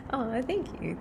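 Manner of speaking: normal talking voice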